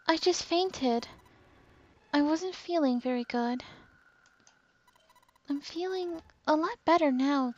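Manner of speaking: put-on high voice of a tired girl; high and gentle, brushing off worry; frail girl voice. June's voice held.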